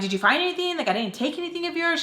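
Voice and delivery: high, pressed protest; anxious questioning. Retold or imitated. Narrator asking through the door about the search, high protest.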